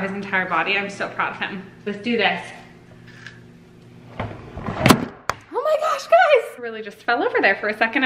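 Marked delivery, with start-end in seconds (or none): 4.2-6.6 high, drawn-out startled cry. Real, not acted. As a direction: caught off guard as it falls. Camera falls over.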